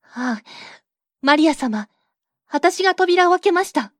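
the queen cries out from the stake; 火刑台から大声で叫ぶ; loud cry. Acted. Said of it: "大声に叫びました".

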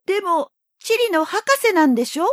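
put-on high, puzzled little boy; young boy voice. A narrator's reading. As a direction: protest like a confused child. Prince protests.

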